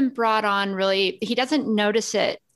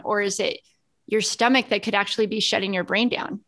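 normal talking voice

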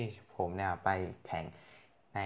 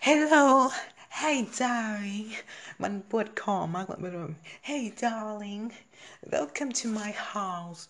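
high, sugary theatrical greeting; storytelling character voice; 11 semitones higher, 4 dB louder, much slower than usual. Shown as a demonstration. Showing how storytelling voices a greeting. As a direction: sing out the welcome like a stage hostess.

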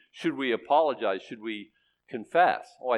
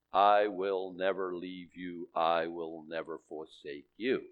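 slow tender promise; 6 semitones lower, 4 dB quieter, slower than usual. Retold or imitated. Preacher voices God's promise.

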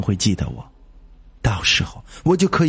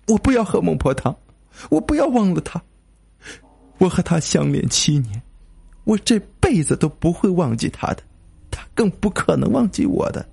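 high, desperate protest; anguished cry; 8 semitones higher than usual. A narrator's reading. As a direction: cry out in pain, pitched well up. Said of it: "我痛苦地喊道". (痛苦地高声哭喊)

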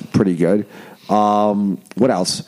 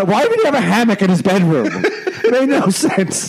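laughing; 9 semitones higher than usual. Real, not acted. Laughing disbelief.

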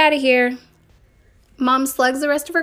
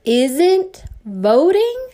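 very slow, higher, louder disbelieving rise; shocked question; 6 semitones higher, 4 dB louder, much slower than usual. Acted. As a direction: ask it as if you can't believe it. Mom shocked by the news.

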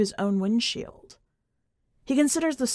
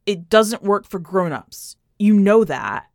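louder, slow insistent warning; urgent plea; 8 dB louder than usual. Acted. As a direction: push it at her, desperate to stop her. Sam urgently pleading on phone.

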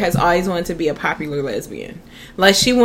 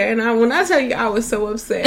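ordinary talk

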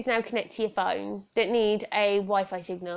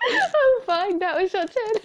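giggly insistence; 10 semitones higher than usual. Real, not acted. Laughing fit after breakdown.

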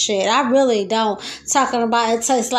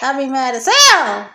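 ordinary talk, nothing marked

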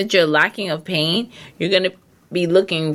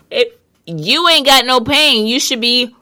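higher and louder, hammering the point; emphatic loud repeat; 6 semitones higher, 5 dB louder than usual. Real, not acted. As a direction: drive the point home loudly.

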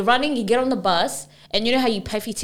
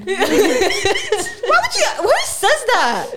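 high, slow, laughing outrage; incredulous laughter, drawn out; laughing disbelief; 13 semitones higher, much slower than usual. Real, not acted. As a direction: laugh through the question in disbelief. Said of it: "You can clearly see I'm in distress".

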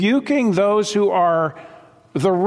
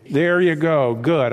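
ordinary talk, nothing marked

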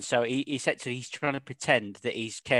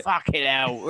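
a lightly voiced Cockney shout off camera; mock Cockney yell, only a hint of it; slower than usual. Host lightly imitates the villain's off-camera Cockney shout. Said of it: "You just hear him shouting".

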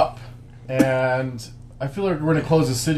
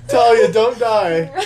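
wheezing through laughter; laughing; 7 semitones higher, 5 dB louder, slower than usual. Real, not acted. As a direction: laugh while pleading. Laughing fit.

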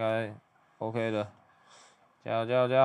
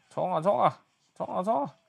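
excited cheering; 8 semitones higher than usual. Real, not acted. Narrator cheering the couple on, high excited yell. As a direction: egg them on like a sports fan.

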